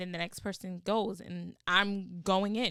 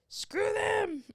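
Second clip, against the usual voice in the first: dismissive; 12 semitones higher, 4 dB louder than usual. Real, not acted. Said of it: "dismissive aside".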